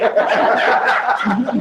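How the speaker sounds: high, loud burst of laughter. Real, not acted. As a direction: cackle at your own comeback. Laughs at own boast.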